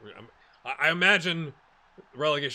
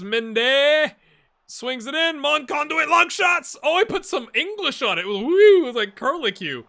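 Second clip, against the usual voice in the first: much higher, louder excited commentary; goal call; 13 semitones higher, 14 dB louder than usual. Acted. Gamer calling a long-shot goal, excited commentator. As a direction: scream it like a live commentator.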